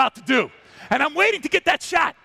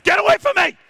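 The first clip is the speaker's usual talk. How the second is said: higher, louder snap; angry lioness; 7 semitones higher, 6 dB louder than usual. Retold or imitated. Lioness driving the male away, loud and high. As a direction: shove him off with a yell. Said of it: "I said".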